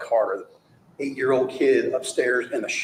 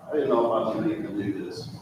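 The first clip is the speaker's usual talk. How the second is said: mocking quote; faster than usual. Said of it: "this stupid lie".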